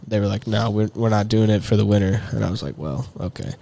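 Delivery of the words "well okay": ordinary talk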